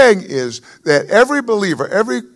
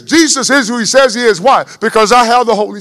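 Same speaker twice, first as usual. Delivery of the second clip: preaching shout; 6 semitones higher, 8 dB louder than usual. Real, not acted. Preacher declares it loudly to the congregation. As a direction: proclaim it high and loud.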